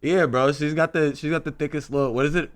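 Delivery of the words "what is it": ordinary delivery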